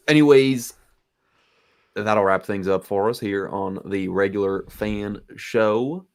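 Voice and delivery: put-on slow Southern drawl, Southern accent. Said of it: "talking in a Southern accent".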